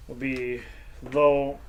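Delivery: normal talking voice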